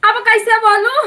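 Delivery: laughing. Real, not acted. Asking the live audience how to speak, laughing. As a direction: giggle out a helpless question. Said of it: "laughing at being unheard".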